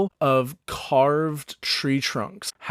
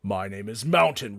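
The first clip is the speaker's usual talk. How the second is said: lower, heavy, stating his name; big orc Mountain; 3 semitones lower than usual. Acted. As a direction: plant the name like a boulder. Mountain introduces himself.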